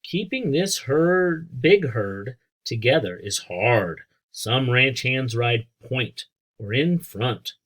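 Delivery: put-on reading to children. A narrator's reading. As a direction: read it out for a class of kids. Teacher reads book to children.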